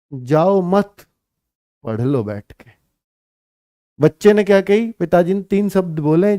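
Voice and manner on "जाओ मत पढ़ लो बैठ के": जाओ मत as one phrase, break, पढ़ लो dropping low and soft; pause after मत. Shown as a demonstration. Teacher voices father's meaning, pause after मत. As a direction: stop after मत, then let पढ़ लो fall. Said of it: "ये पिताजी ने बोला".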